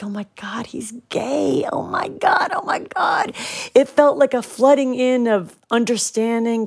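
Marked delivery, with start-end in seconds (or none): 0.0-3.3 breathless whispered realization, rising high; awed whisper. Retold or imitated. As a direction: hush it out as the truth sinks in.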